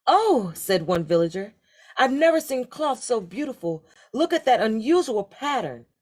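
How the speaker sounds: high, awed exclamation; a villager gasping at the beautiful cloth; amazed villager. Acted.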